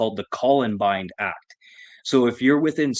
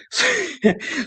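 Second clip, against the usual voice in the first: sudden high cackle of a laugh; real amused laugh; high burst of laughter. Real, not acted. Laughing at the cops.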